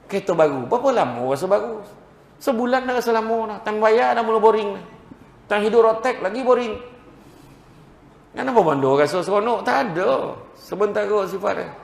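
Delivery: high, loud, urgent sermon push; fiery preaching. Acted. Preacher urging the crowd to weep for God, fiery preaching. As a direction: drive it home to the congregation.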